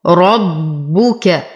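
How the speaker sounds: slow ربك, heavy ra and held double ba; doubled ba. Shown as a demonstration. Teacher reciting for students, slow ربك with doubled ba. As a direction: press on the ba of ربك, round its u fully. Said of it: "نشد الباء ونتم ضمتها ونفخم الراء".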